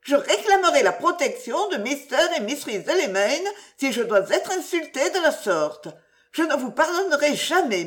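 high, offended lady's protest; indignant threat. A narrator's reading. Widow threatening never to forgive his kiss, high indignation. Feigned outrage after the kiss.

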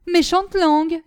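high, loud indignant echo; offended retort. A narrator's reading. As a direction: throw the insult back in outrage. Acted quarrel, insult thrown back.

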